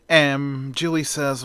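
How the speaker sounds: ordinary delivery, nothing marked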